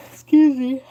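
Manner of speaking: words stretched through a loud yawn, pitch jumping high. Real, not acted. Excusing herself to the viewer, spoken through a big yawn. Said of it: "Sorry, I just yawned".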